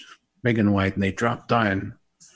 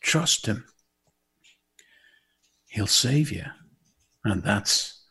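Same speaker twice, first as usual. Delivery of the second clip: normal talking voice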